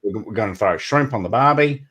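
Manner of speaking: hammed-up Aussie twang. Demonstrating Australian for friends, broad mock Australian accent. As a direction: mimic a cliché Australian planning a night. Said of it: "So that's Australian".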